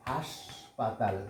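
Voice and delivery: হাসপাতাল stretched out slowly and softly, syllable by syllable; slow spelling demo. Shown as a demonstration. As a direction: drag out হাসপাতাল gently so each sound is clear. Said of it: "হাসপাতাল মানে hospital". (হাসপাতাল ধীরে ধীরে টেনে উচ্চারণ)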